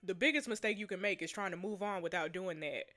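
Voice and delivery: low, soft consoling read; story reading. Acted. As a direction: read it as quiet, sober consolation. Reads consoling story dialogue.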